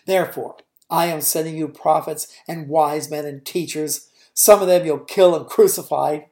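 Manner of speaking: Jesus warning the leaders of what they'll do; Jesus denouncing. Acted. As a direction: build to a loud accusation.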